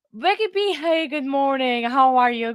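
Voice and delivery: high, bright hello to a friend; light up greeting a regular; excited greeting. Real, not acted.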